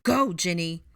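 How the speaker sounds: loud, urgent order; forceful commanding yell; shouted command. A narrator's reading. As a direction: order your daughter to run. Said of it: "Arthur commanded".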